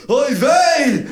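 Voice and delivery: high, loud startled cry; shouted Oy vey. Retold or imitated. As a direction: yell it like a mum braking hard. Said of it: "she shouts out".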